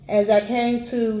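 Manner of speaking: usual speaking voice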